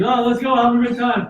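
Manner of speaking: high, breezy mock-cheer; a carefree partier, lightly sent up. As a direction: play a carefree party-goer, light and lilting. Mocking carefree eating attitude.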